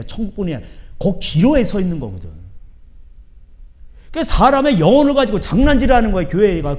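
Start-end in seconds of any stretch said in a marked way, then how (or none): 4.1-6.8 loud, higher outcry; decry it with outrage; indignant preaching. Real, not acted. Indignant preaching about churches. (분개하여 외치는 설교)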